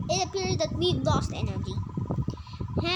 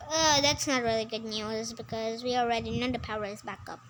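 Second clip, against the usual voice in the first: ordinary delivery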